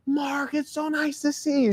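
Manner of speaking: high, soft, slow sweet welcome; aunt greeting her nephew with delight; thrilled aunt. Retold or imitated. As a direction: gush over the boy like a doting aunt. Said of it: "she was just so thrilled to see you".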